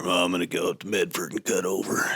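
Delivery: a hint of a self-satisfied Harley rider; exaggerated biker guy. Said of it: "I'm exaggerating his voice a little bit".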